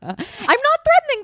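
high, drawn-out protest. Real, not acted. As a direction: stretch it up high and slow. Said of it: "That was me saying nice".

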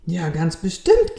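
ordinary delivery, nothing marked

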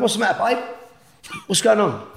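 confused husband calling to his wife; confused worried call. Retold or imitated. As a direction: call out, not knowing what happened. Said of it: "I'm going".

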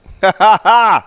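a big burst of high laughter; real laughing burst; loud high laugh. Real, not acted. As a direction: crack up loud.

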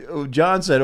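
ordinary talk

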